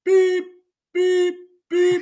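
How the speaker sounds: high, loud beep beep beep like a reversing truck; truck backup beeping. Retold or imitated. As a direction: squeak out the backup beeps. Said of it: "We backing it up?".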